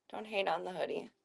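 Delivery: ordinary talk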